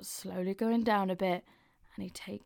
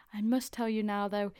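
usual speaking voice

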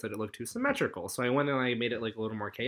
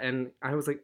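ordinary delivery

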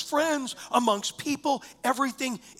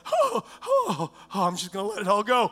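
ecstatic letting go; 3 semitones lower, 3 dB louder than usual. Pretend. Mock letting go in worship.

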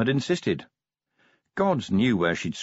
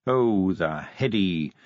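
ordinary delivery, nothing marked

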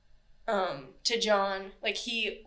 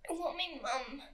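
higher, softer mock toddler whimper; lightly voiced little child wanting mum; mimicking a toddler; 5 semitones higher, 8 dB quieter than usual. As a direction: imitate a little boy crying for his mum. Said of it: "He said it like this. Are you ready?".

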